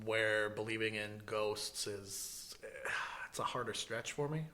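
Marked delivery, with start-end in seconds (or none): 1.9-4.5 hushed whisper. Real, not acted. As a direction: drop to a whisper. Aside about ghosts, whispered.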